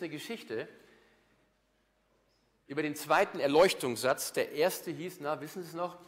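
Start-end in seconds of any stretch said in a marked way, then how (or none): none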